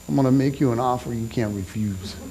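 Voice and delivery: low, quiet mob-boss rasp of a Godfather offer; Marlon Brando Godfather. Retold or imitated. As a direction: lean in low and soft like a mafia don. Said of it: "The Marlon Brando thing".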